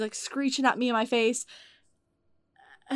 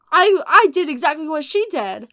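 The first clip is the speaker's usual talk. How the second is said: loud, higher-pitched self-defense; indignant retort; 4 semitones higher, 8 dB louder than usual. Retold or imitated. Teen worker defends herself to the customer's mother, indignant. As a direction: protest it with raised voice, stressing each point.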